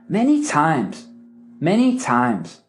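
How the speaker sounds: MANY TIMES falling firmly at the end; command intonation. Shown as a demonstration. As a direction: say MANY TIMES slowly as a command, tune dropping. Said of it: "That's a command".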